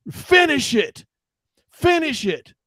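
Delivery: high-pitched mock scream, falling sharply; imitated villain's scream. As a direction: shout it like a movie villain's command, pitched high. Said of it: "he screams at her and says".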